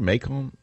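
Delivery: Macon as may-KON, with a full second vowel; mispronounced Macon. Shown as a demonstration. Host asking caller how to say her Georgia town, Macon mispronounced as may-kon. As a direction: ask Macon unsurely as MAY-KON, not MAY-kin. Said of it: "I said it wrong".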